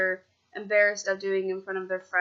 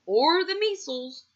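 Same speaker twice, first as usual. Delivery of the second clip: doctor voice; 9 semitones higher, slower than usual. Acted.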